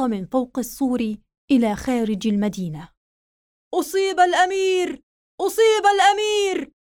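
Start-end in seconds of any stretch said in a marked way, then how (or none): 3.7-6.7 alarmed shout. Acted. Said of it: "قالها أحد الجنود".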